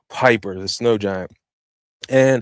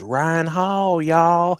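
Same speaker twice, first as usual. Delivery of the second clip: higher, drawn-out Southern "Ryan Hall, y'all"; Southern twang shout-out; 5 semitones higher, much slower than usual. Retold or imitated. Hyping the YouTube weatherman with his Southern catchphrase. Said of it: "He got this little Southern twang".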